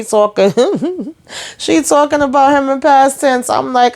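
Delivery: high, laughing retelling; real amused disbelief. Real, not acted. Cracking up at the character's nerve.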